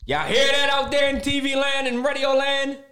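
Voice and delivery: high, loud hype call; radio announcer. Acted. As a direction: announce it to the listeners.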